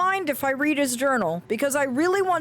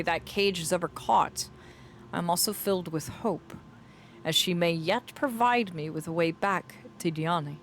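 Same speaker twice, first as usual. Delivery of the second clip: measured, lower storytelling voice; dramatic journal narration; 5 semitones lower, 4 dB quieter than usual. Acted. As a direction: read the journal as its brooding writer, with deliberate pauses. Resumes reading the journal aloud.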